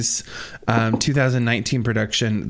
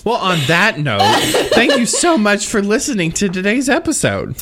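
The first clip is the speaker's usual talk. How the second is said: laughing outro, higher and louder; 8 semitones higher, 5 dB louder than usual. Real, not acted. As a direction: sign off while laughing. Laughing through show outro.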